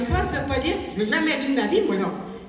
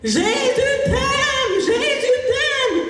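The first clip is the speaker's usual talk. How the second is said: very high, louder sung cry; woman running naked shouting her message; naked street preacher; 11 semitones higher, 4 dB louder, slower than usual. Retold or imitated. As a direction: chant it out like a loopy evangelist. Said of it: "Puis elle s'en vient".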